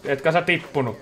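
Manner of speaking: ordinary talk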